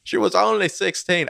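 high-pitched attempt at Cockney; Michael Caine. Friend trying an impression she says fails, high Cockney accent. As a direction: put on a strained Cockney impression. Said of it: "Michael Caine impression video".

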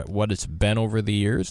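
ordinary delivery

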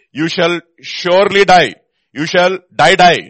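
slow, loud, each word weighted; solemn, drawn-out warning; emphatic double die. Shown as a demonstration. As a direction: hammer home the doubled die. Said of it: "Whenever there is a double, it's an emphasis".

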